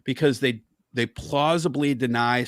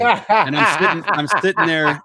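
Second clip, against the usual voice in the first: laughing through the words, higher and louder; 5 semitones higher, 6 dB louder than usual. Real, not acted. Laughs while restarting the story.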